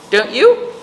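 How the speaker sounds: ordinary delivery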